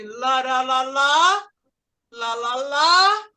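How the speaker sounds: sing-song "lá, lá, lá" stretched and rising; question intonation demo. Shown as a demonstration. Teacher hums the tune of a yes-or-no question, rising intonation. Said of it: "você faz a pergunta assim".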